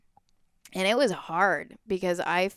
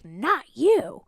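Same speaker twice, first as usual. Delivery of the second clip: high, drawn-out mock call; imitated rejection; 7 semitones higher, 3 dB louder than usual. Man at the window turning her down, imitated heckle. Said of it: "And he's like".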